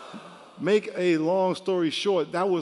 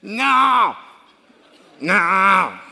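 louder stern no; loud firm no; 3 semitones higher, 8 dB louder than usual. Retold or imitated. Grandfather loudly refusing his grandson at bedtime. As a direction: put your foot down.

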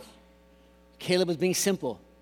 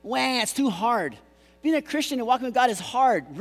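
mock crying; 6 semitones higher than usual. Preacher mimicking Christians who find it hard, mock-crying. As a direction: whine it like a mock crybaby. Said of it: "They cried".

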